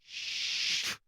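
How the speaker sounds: unvoiced hushed shh; shushing whisper. Real, not acted. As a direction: hush him up in a whisper. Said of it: "you just said it on air".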